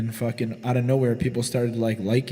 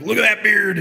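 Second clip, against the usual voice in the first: bloody bum marveling; 8 semitones higher, 6 dB louder than usual. Retold or imitated. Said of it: "was like".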